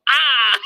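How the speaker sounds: short, high, loud imitated yell "Ah!"; playful imitation of a startled yell; mock yell. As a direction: belt out the meme's yell. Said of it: "and they go".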